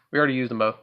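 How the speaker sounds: ordinary talk, nothing marked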